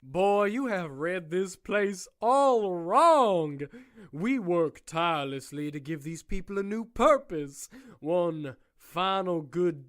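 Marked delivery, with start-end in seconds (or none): 0.0-3.6 loud, slow, relishing the word; booming correction. Acted. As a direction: savour telling him he is wrong. Warden gleefully corrects.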